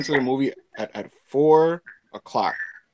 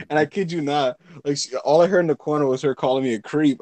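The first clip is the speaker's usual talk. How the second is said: usual speaking voice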